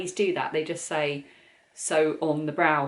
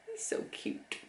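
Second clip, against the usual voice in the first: whispered, soft and breathy aside; 14 dB quieter than usual. Real, not acted. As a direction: whisper it fondly to the toy. Cooing over a knitted penguin.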